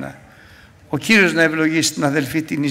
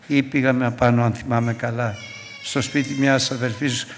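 ordinary delivery

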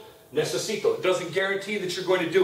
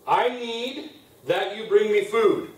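usual speaking voice

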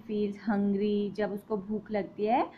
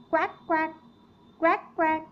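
high nasal-free quacking, mock duck; duck quack imitation; 7 semitones higher, 4 dB louder, faster than usual. Shown as a demonstration. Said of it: "duck कहती है". (बत्तख की नकल करती quack quack)

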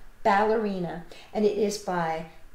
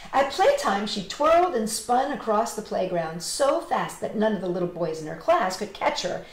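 ordinary delivery, nothing marked

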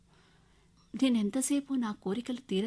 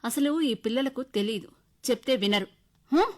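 loud, higher, exasperated mother; angry scolding; 5 semitones higher, 9 dB louder than usual. A narrator's reading. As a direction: scold the child at full volume. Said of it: "కోపం వచ్చింది నాకు". (కోపంగా గట్టిగా తిట్టే అమ్మ)

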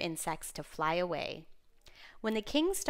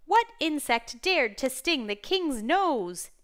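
loud, high, slow outrage; storybook king shouting in outrage, drawn out; angry shout; 5 semitones higher, 6 dB louder, slower than usual. A narrator's reading. Storyteller as the stung king demanding the culprit, angry shout. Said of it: "he shouted".

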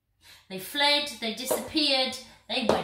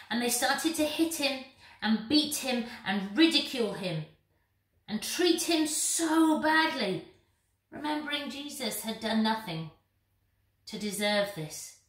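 ordinary talk, nothing marked

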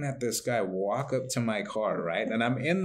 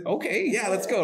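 much higher, bouncy agreement; eager yes; 9 semitones higher than usual. Retold or imitated. Invited to dinner at Bacon Nation, high eager yes. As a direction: jump at the invite.

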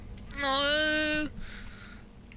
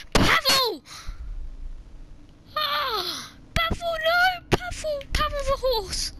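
mock-tragic wailing of a name; anguished cries; 12 semitones higher than usual. Real, not acted. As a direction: cry out in horror for the horse. Horse being killed in game.

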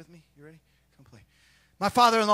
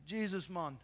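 light Jamaican Jesus, man; quiet Jamaican Jesus, man; Jamaican accent; 7 semitones lower, 13 dB quieter than usual. Shown as a demonstration. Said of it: "In Jamaican".

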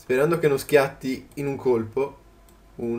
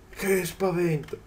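slow, softer drawn-out repeat of the scare; slow quiet echo; 2 semitones higher, 5 dB quieter, slower than usual. Real, not acted. Gamer repeating his fright to himself, slow and soft. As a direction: mull over the fright, slowly and quietly.

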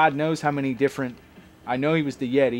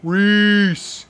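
loud, higher, awkward fan call; awkward crowd voice; 7 semitones higher, 8 dB louder than usual. Retold or imitated. Imitating a fan reacting to a wrestler's taunt, awkward shout. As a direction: imitate an oddball heckler calling out a name. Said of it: "like, in this awkward voice".

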